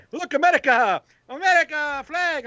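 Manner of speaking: high, showy pointing at the flag; mock patriot. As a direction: make sure the stranger sees you. Mimicking the dad's showy patriotism.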